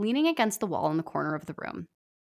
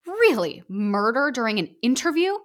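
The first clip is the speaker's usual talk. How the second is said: high, louder outraged scold; indignant scolding; 4 semitones higher, 5 dB louder than usual. Acted. Author scolding Voldemort for cursing during an interview, loud and outraged. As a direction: scold him in disbelief.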